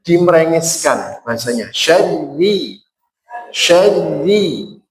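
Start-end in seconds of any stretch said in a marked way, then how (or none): 1.7-4.8 SYARRI, tongue lowered, thin ra; thinned syarri. Shown as a demonstration. Teacher shows lowered tongue, Syarri said thin. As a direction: spread the lips and keep Syarri light. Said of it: "Kalau ingin tipis, lidahnya diturunkan".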